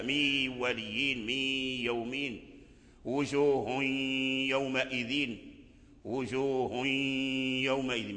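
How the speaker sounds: slow chanted من ولي with partial nasal merging; recitation with ghunna. Shown as a demonstration. Teacher reciting examples of partial idgham, slow. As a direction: draw the words out in tajwid. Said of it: "سبق لنا هذا".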